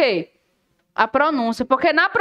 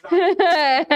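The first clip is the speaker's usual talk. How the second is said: laughing yes; 4 semitones higher than usual. Real, not acted. Laughs while confirming student.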